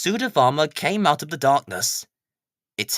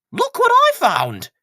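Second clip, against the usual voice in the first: much higher, eager call; excited find; 8 dB louder than usual. Acted. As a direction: show off your discovery. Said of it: "he said".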